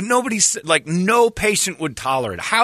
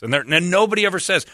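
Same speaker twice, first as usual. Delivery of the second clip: normal talking voice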